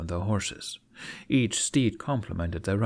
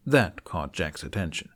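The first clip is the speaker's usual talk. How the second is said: ordinary delivery, nothing marked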